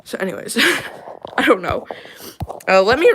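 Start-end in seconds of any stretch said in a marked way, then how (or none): 0.6-1.3 light laugh. Real, not acted. Laugh at own appearance.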